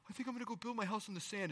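half-hearted fool voice; foolish man. Retold or imitated. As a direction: toss it off like a careless fool.

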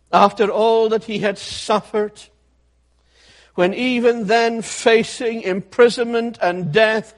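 loud, impassioned pulpit preaching; fiery preaching. Acted. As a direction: preach it out loud, pleading with sinners. Building preaching crescendo.

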